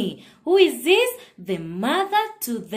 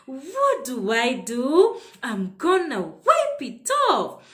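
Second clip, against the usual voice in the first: normal talking voice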